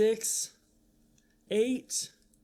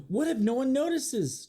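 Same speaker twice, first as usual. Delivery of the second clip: higher, anxious question; a frightened truck asks, higher and anxious; worried high voice; 8 semitones higher than usual. Acted. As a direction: fret like a scared little truck. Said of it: "Trucker trembled".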